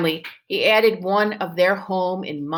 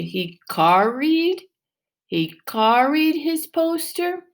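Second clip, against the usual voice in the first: drawn-out "carried", rising high, questioning; slow sounding out; 7 semitones higher, slower than usual. Shown as a demonstration. Teacher slowly testing "carried" in front of the class. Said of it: "Does that make sense to you?".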